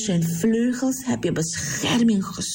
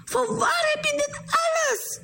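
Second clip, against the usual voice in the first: much higher, astonished cry to Ruth; amazed calling out. Acted. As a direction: call out in amazed delight. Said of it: "En ze riep".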